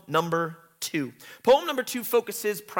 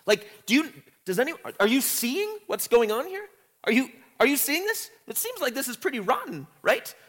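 higher, fast pleading for someone to see; seeking validation; 8 semitones higher than usual. Retold or imitated. Teacher paraphrasing Zion begging for validation, higher. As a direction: urge them to notice, quickly. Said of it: "a cry for almost validation".

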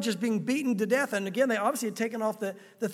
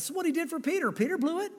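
much higher, louder protest; protesting listener; 8 semitones higher, 3 dB louder than usual. Retold or imitated. Imitating a listener objecting to the pastor, high and loud. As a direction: rise into an objection.

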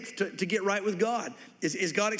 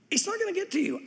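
reassuring; 10 semitones higher than usual. Acted. God reassuring his people.

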